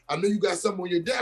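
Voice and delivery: retold tearful begging. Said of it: "and I instantly start crying".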